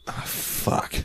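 hushed, breath-only curse; dismayed whisper. Retold or imitated. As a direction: whisper it to yourself, retelling dismay. Realizes he has no car keys.

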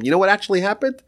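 mild mock yell at the simpleton; mock scolding. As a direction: rattle off the rebuke impatiently. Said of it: "he's screaming at him".

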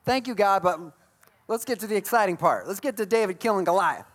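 a touch of dutiful thanks, then eager; mock polite impatience. Bored reader skipping the Chronicles genealogies, lightly mock impatient. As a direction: humor the text, then rush on. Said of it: "you're like".

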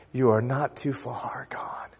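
soft unvoiced whisper of the Lord's words; hushed whisper, barely breathed. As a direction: breathe it like a secret comfort. Lord's words quoted in prayer.

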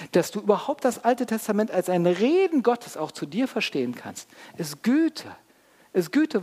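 high, loud, insistent sermon delivery; impassioned preaching. Real, not acted. Preacher urging listeners to take the whole package, impassioned. Preacher keeps up full force.

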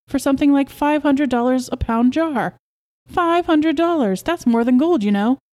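high, eager marvelling at a price; excited boast. Acted. Husband tells wife what royal jelly costs, excited. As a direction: impress her, pitch jumping up.

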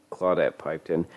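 ordinary delivery, nothing marked

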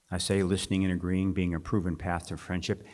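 ordinary talk, nothing marked

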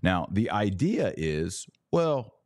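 ordinary talk

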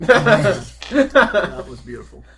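slow, loud, drawn-out mock reverence; mock awe. Player mock-moved by the explosion, slow and drawn out. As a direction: savour each word like a moved fan. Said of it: "player mock-moved by explosion".

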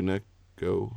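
"Nico" stretched into two parts, soft; drawn-out name. Real, not acted. Host checking with the co-host by name, drawn-out. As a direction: say "Nico" slowly, split as Nick-o. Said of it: "I was just stretching it out".

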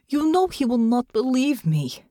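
desperate plea. A narrator's reading. Natasha desperate.

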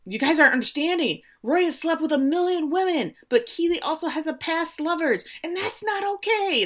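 Ted getting in the Diamond Dogs' faces, fast and high; Ted getting in their face. Retold or imitated. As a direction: push it at them, talking fast and high. Ted gets right in his face.

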